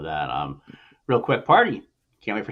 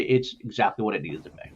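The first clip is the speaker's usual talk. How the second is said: normal talking voice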